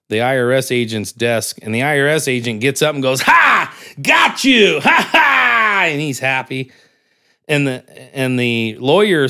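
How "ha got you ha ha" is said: loud, much higher gloating yell; triumphant shout. Retold or imitated. IRS agent gloating at the old man, loud high triumphant shout. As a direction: crow it out like you just won big.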